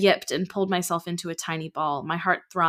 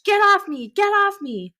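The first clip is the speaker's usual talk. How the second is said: screaming; 7 dB louder than usual. Acted. Jim fighting his captors.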